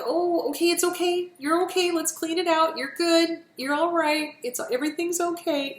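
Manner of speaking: put-on calm a kid with a scraped knee; soothing a hurt child. Shown as a demonstration. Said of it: "soothing, comforting".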